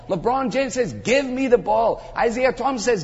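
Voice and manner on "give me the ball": insistent demand. Acted. Preacher voicing star athlete wanting to score, insistent demand.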